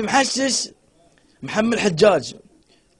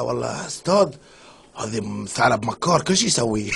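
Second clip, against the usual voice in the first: put-on lowered Baluchi accent; 11 semitones lower, 5 dB quieter than usual. Baluchi student answers in joke.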